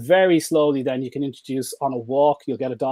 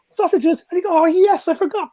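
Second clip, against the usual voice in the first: excited dog; 6 dB louder than usual. Retold or imitated.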